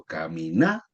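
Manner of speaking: "caminata" sounded out with a rising, held NA; stress on NA. Shown as a demonstration. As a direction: lengthen the NA in "caminata". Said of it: "En la na".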